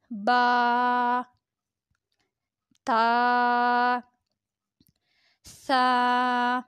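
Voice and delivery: each letter name held long on one level tone; drawn-out letters. Shown as a demonstration. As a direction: stretch each vowel evenly, five counts long. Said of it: "پانچ انگلیوں کے برابر کھینچیں گے".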